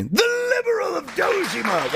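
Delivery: high, theatrical character shout; Joker. Acted. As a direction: belt it out as a hammed-up villain voice. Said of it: "Why did I do it again?".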